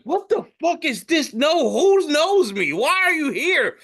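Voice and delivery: high-pitched, hammed-up indignant outburst; mock panicked protest. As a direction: act horrified at being recognised, voice way up. Acts out being recognised at work.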